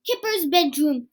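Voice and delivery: ordinary delivery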